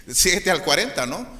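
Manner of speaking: normal talking voice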